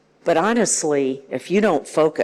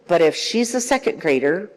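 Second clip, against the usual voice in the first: usual speaking voice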